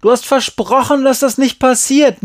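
very high, loud reproach; yelled accusation. A narrator's reading. Boy reminds friend of her promise, yelling. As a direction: hurl the broken promise at her. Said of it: "brüllte Glenn zurück".

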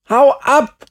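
very high, loud yell; angry boy screaming; furious shout. A narrator's reading. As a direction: yell it to drive someone away. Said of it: "schrie Markus".

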